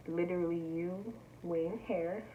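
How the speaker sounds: usual speaking voice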